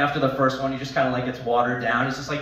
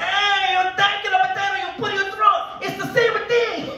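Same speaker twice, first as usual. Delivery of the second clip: put-on high, loud old Italian nonna; Italian grandmother; 6 dB louder than usual. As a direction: shout remedies like a pushy grandma. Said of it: "My grandmother would chime in like".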